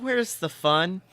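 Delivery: usual speaking voice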